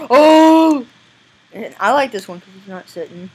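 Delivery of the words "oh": loud, high "oh" howl; imitated character cry. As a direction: hoot out a big drawn "oh". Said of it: "He goes".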